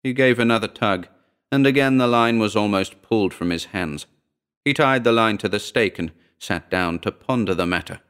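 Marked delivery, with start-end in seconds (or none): none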